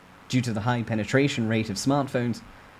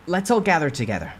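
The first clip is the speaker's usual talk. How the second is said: calling out; 7 semitones higher, 5 dB louder than usual. Acted. Narrator calls survivors together.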